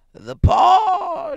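very high, loud shriek of sudden revelation; frantic old woman's piercing cry; wild cry. A narrator's reading. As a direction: shriek it wildly, stretching the last word. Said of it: "she cried wildly".

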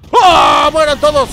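loud yell to kill them all; battle cry. Real, not acted. Gamer yelling a battle cry at the enemies. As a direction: roar the attack at the enemies.

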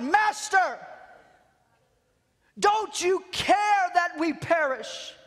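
high, drawn-out desperate plea; crying out. Acted. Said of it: "they cry out".

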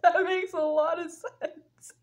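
talking through a laugh, drawn out; slow, giggly, words squeezed out by laughter. Real, not acted. Storyteller cracking up at herself, laughing.

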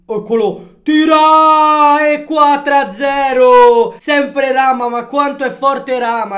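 shouted, stretched play-by-play; breathless italian goal commentary. Real, not acted. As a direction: call the goal like a live commentator.

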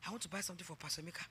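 put-on fast, soft, eager child's rush. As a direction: rattle it off like an excited girl. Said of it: "she said".

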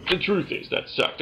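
ordinary talk

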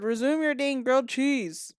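huffy mock order to get back to eating; mock scolding. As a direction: scold the Sim like a fed-up mom. First scolding take at the Sim.

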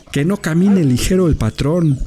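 high-pitched, exclaimed warning; Prince. A narrator's reading. Dog frets about his master walking on, high exclamation. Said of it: "exclamó Prince".

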